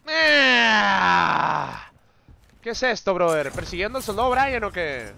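loud shocked yell at the ambush; outraged shout. Real, not acted. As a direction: shout it out, caught off guard. (grito indignado y fuerte)

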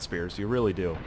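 ordinary delivery

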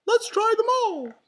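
very high, loud falsetto mouse; rally friends in a cartoon falsetto; Mickey Mouse. A narrator's reading. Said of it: "said Mickey".

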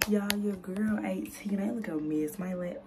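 whispering. Real, not acted. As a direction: hush it down to a whisper. Late-night talk at home.

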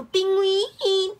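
high, loud, stretched-out name call; soprano-like cry. Pretend. Penguin toy calls its own name, soprano-like high cry. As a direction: sing it out like a soprano. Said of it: "You almost sound like Soprano".

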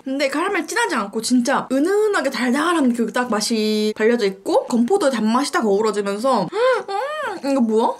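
eating between words; talking while chewing. Real, not acted. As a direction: mouth partly full. Seen 2: mouth moves with food, rusk in hand throughout.

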